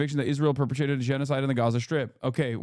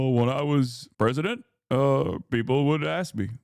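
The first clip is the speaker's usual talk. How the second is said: a rough, lightly voiced Obama with measured pauses; halting, deliberate presidential cadence, barely practiced; Obama impression; 2 semitones higher than usual. Streamer reads Obama's interview quote in a shaky Obama impression. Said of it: "practicing my Obama".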